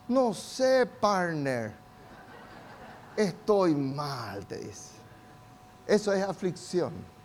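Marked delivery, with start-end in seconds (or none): 0.1-4.5 soft, slow, slumped half-laugh; mock down-and-out bro. Preacher voicing a gloomy young man to his friend, soft and slow. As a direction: play a guy who just feels bad and laughs it off.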